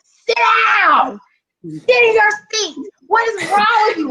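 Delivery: yelling. Retold or imitated. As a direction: bark orders at an unruly class. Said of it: "I started yelling".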